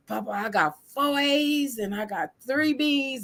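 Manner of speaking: a hint of a kid bragging about grades; proud child. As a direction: grandson reporting his grades to Papa, lightly voiced child. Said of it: "And he".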